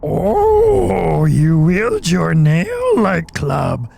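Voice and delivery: slow, strained attempt at an old master; Yoda. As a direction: drag it out like a tiny old master. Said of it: "I can't do Yoda with my voice like this".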